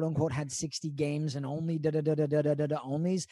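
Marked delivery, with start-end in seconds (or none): none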